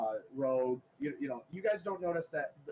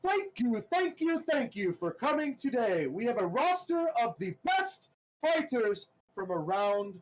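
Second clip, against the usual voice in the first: booming announcer; 6 semitones higher than usual. Acted. Game master voicing the arena announcer to the players. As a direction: announce it to the whole crowd. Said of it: "and it says".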